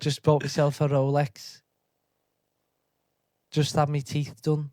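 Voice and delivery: slow, lightly put-on Scouse; drawn-out Liverpool lad accent. Playing a bragging lad, slow light Scouse. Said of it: "I'll Scouse".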